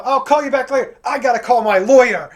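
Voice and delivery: loud, high, indignant threat; angry threat. Retold or imitated. Fed-up customer threatening agent before hanging up, indignant. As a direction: threaten them as you end the call.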